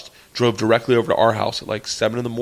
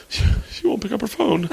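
trembling voice; 9 semitones higher than usual. Retold or imitated. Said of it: "it is fucking trembling".

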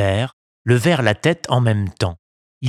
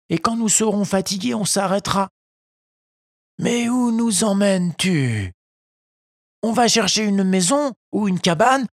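put-on high boyish puppet voice; gentle, high puppet voice guiding his old papa; young puppet; 11 semitones higher than usual. A narrator's reading. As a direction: Pinocchio cheering up his weak father. Voices Pinocchio in story.

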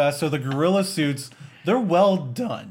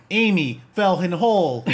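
mock robot voice, pitched up; higher, robotic narration of the obvious; stupid robot voice; 4 semitones higher, 3 dB louder than usual. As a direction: imitate a silly talking-device voice. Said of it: "this stupid robot voice".

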